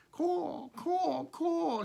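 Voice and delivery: high cawing crow; three high crow calls; caw, caw, caw like a baby crow. A narrator's reading. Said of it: "said the little crow".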